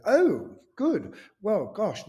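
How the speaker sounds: high, loud delighted gush; gushing greeting. Retold or imitated. Actress mistaking a castmate for wig man, gushing.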